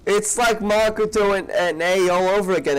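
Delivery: excited. Real, not acted. Streamer reacting to the story reveal.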